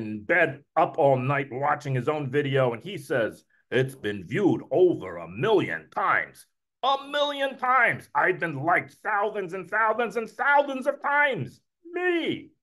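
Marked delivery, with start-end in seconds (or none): 3.7-6.5 slow, low New York drawl; New York accent. Acted. Uncle Murray reporting his video views, New York accent. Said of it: "I give him a New York accent". 6.8-8.1 put-on high, slow, amazed New York accent. Uncle crowing over his video views, excited New York accent. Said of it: "I give him a New York accent". 8.1-12.5 high-pitched New York boasting. Acted. Uncle boasting about his likes, excited New York accent. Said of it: "I give him a New York accent".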